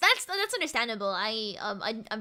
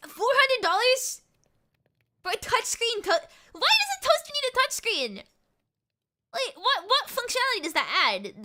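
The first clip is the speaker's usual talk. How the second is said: much higher, louder disbelieving rant; incredulous outburst; 11 semitones higher, 4 dB louder than usual. Real, not acted. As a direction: sound scandalised by the price. Outrage at pricey toaster.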